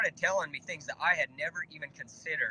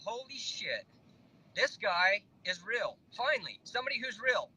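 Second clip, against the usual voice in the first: amazed; 7 semitones higher than usual. Retold or imitated. Thrilled realisation on the phone.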